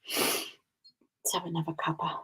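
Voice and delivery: soft unvoiced whisper. Real, not acted. Showing viewers her cross stitch, slow whisper. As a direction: breathe it out, slow.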